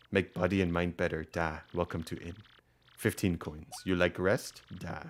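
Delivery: put-on low-key Russian-accented host; rough Russian accent. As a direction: welcome a guest in a quiet, loose Russian accent. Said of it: "I can't do a Russian accent".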